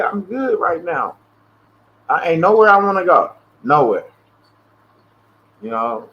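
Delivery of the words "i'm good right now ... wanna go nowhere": firm refusal. Retold or imitated. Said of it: "I said".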